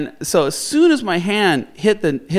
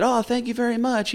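polite thanks; 6 semitones higher, 5 dB quieter than usual. Retold or imitated. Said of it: "we don't wanna be rude".

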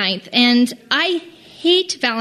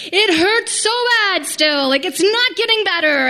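retold pained whine; 6 semitones higher than usual. In labor pain, in the trough.

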